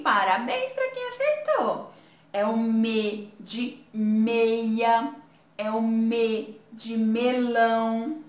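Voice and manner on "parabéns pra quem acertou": praise. Real, not acted. Praising children.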